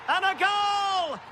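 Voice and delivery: high, shouted goal announcement; excited goal call. Real, not acted. Commentator calling the goal that puts them in front, excited shout. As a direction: cry out the goal, stretching it out.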